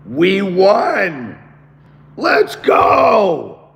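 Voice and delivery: high, loud hoarse shout of triumph; victory cheer. Real, not acted. Streamer tells his chat they won, shouting in triumph. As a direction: yell it like you just won. Celebrating election win on stream.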